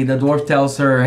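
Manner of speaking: ordinary talk, nothing marked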